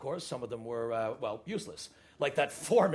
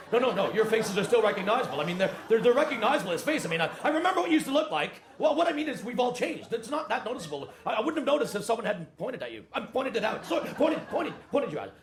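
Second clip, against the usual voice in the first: higher, rising panic of an awkward apology; flustered backtracking; 6 semitones higher than usual. Acted. Boss excusing a gaffe about survivors' faces, flustered.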